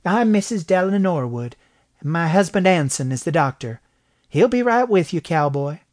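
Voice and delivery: high, friendly female greeting; high-pitched doctor's wife, welcoming; smiling woman. A narrator's reading. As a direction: greet a visitor with a smile. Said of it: "smiling woman greeted him".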